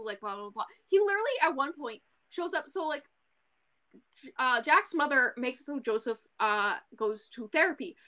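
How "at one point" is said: usual speaking voice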